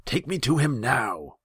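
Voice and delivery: high, loud order; imperious shouted order; impatient command. A narrator's reading. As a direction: demand to be taken at once. Impatient khan orders his advisor.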